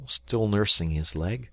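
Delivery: usual speaking voice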